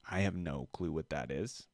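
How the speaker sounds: ordinary delivery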